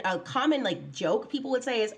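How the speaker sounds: ordinary delivery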